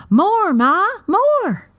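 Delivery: put-on high, loud, demanding little-girl cry; child's shout. A narrator's reading. Toddler shouting at mother to keep singing, high demanding call. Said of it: "and she shouted".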